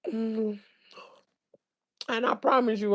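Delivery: ordinary talk, nothing marked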